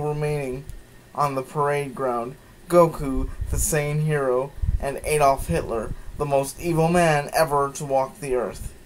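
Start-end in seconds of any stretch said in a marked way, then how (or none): none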